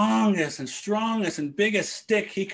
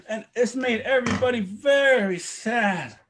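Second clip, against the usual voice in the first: usual speaking voice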